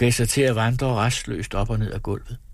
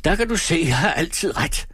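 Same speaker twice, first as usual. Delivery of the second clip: high, crowing victory cry; gleeful, pitched-up triumph; triumphant shout; 9 semitones higher than usual. A narrator's reading. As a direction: crow that you were right all along. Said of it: "råbte triumferende".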